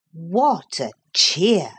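usual speaking voice

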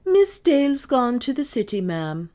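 high, loud, formal answer; prim rebuff. A narrator's reading. Maid reporting to mistress, primly rebuffed. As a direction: answer with offended primness. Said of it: "assumed an attitude of prim rebuff".

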